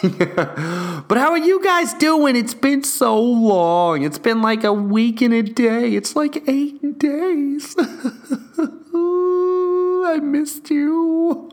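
hammed-up cutesy; mock sweet voice. Real, not acted. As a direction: coo at the viewers like a pet. Mock-sweet greeting to viewers.

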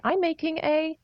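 high-pitched mock video intro; put-on high vlogging voice; acted vlogger voice. Re-enacting own early video chirpily.

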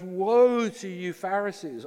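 high, slow aching woe; emotional woe. Pretend. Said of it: "it's like this emotional response".

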